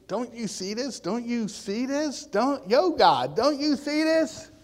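high-pitched, urgent appeal to God; retold disbelief, pitch climbing; incredulous pleading.

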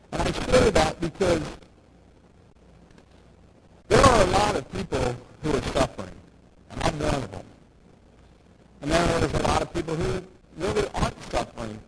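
ordinary talk, nothing marked

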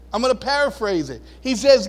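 ordinary talk

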